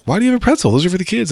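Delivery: high, surprised question; surprised and a bit scolding. Retold or imitated. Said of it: "She was surprised".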